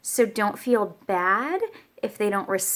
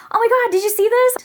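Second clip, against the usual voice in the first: much higher, louder squeal; excited; 13 semitones higher, 6 dB louder than usual. Retold or imitated. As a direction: friends gushing in disbelief. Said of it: "they're like".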